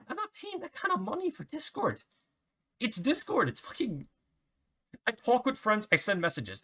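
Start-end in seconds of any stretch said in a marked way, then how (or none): 0.1-4.1 exasperated outburst. Real, not acted. Exasperated rant about paying.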